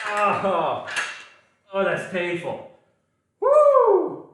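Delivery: loud, high relieved cheer; excited whoop. Real, not acted. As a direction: whoop with relief after the burn. Relief after a hard set.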